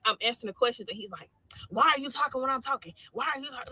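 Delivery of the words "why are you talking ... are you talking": higher-pitched mock scolding; raised, mildly indignant retelling; retold upset sergeant. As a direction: imitate a sergeant cutting someone off. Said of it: "he's getting upset".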